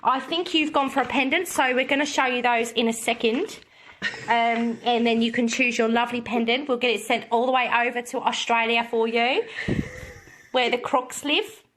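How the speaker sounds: put-on Australian, presenter-like; amateur Australian host; Australian accent.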